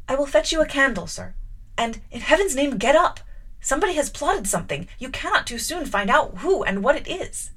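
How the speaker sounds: urgent pleading. A narrator's reading. Jane urgently pressing wet Rochester to get up. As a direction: hurry him up, anxious. Jane urging him, hurried.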